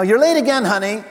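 nagging spouse. Acted. Spouse greeting a late partner, mock nagging.

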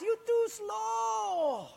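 high, slow, drawn-out taunting shout; mocking Portuguese guy. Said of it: "that Portuguese guy laughed".

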